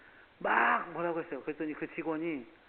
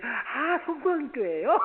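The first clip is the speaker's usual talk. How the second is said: friendly surprise; 10 semitones higher, 9 dB louder, slower than usual. Retold or imitated. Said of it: "clerk greets on the phone".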